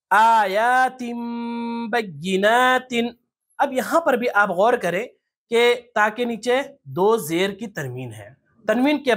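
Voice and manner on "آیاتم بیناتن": very slow, chanted آیاتم بیناتن with long vowels; iqlab recitation. Shown as a demonstration. Teacher reciting the next example, iqlab with held m. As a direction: hum the m of آیاتم before بیناتن. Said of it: "آگے ایک مثال دیکھ لیتے ہیں".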